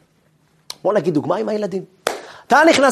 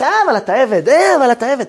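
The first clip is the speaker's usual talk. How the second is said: nagging inner resistance; 6 semitones higher, 5 dB louder than usual. Acted. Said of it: "ואז ההתנגדות הטבעית של המוח".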